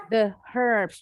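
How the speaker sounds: herbs with the H made obvious; British, clear H. Shown as a demonstration. Teacher underlining the English way, herbs with clear H. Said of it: "Jadi haknya jelas kalau orang Inggris".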